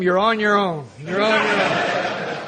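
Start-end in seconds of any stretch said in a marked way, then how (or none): 1.0-2.4 drawn out slowly through a laugh; slow laughing repeat. Shown as a demonstration. Speaker repeating the disclaimer to the room, laughing slowly. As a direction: let the warning dissolve into laughter, stretched out. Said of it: "If you use them".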